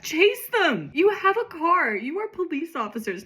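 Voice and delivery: high, incredulous heckling; amused, high-pitched exasperation; exasperated outburst. Real, not acted. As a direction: scold the useless officers, pitch way up. Exasperated at the useless police characters.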